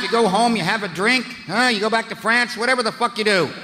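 usual speaking voice

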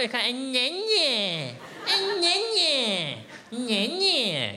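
high, slow, stretched "An onion"; exaggerated, sing-song "onion"; drawn-out "onion". Shown as a demonstration. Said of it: "shouting the word onion".

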